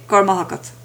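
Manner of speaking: gur maith agat slurred together quickly, with raibh swallowed. Shown as a demonstration. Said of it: "Again, said quickly".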